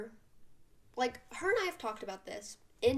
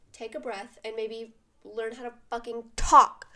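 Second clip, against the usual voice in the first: ordinary delivery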